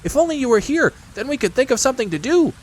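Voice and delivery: high, eager little-animal voice; eager Piglet. Acted. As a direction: plead in a high little pipe.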